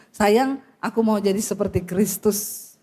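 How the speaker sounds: low-pitched, lightly acted husband; a hint of an earnest husband; husband to wife. Shown as a demonstration. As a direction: say it low, as a pledge to your wife. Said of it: "Coba bilang sama istrimu".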